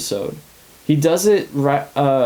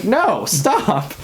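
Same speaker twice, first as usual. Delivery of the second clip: higher, frantic imitation of Vision begging her to stop; half-hammed panic, pitched up; mock panicked protest; 8 semitones higher, slower than usual. Vision pleads with Wanda not to end the episode, mock frantic. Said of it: "He's freaking out".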